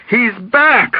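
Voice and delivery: high, hysterical cry; hysterical calling out. A narrator's reading. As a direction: call out the alarm in hysteria. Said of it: "calling out hysterically".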